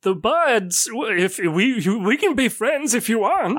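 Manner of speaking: lightly voiced eager old man; a hint of a hopeful elder; old shopkeeper offering friendship.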